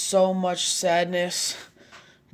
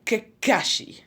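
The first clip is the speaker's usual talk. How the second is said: higher, louder shout of a name; sudden call-out; 6 semitones higher, 4 dB louder, slower than usual. Acted. Rival coming out of nowhere, sudden call-out. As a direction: burst in calling his name. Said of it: "somebody came out of nowhere saying".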